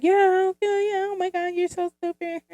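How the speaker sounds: very high, bashful mock brush-off. Acting out how she shrugs off compliments, high and bashful. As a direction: wave the compliment away, pretending to be embarrassed. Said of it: "I just be like".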